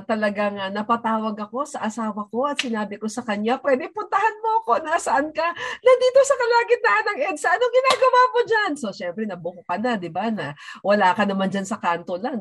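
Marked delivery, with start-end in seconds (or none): 3.6-5.5 high, fast retold plea to her husband; quick high-pitched back-and-forth; voicing the phone call. Wife calling her husband stranded on EDSA, high and fast. 5.8-8.7 high, loud, fast retold exchange; voicing the phone call. Wife stuck on EDSA telling her husband, high and loud.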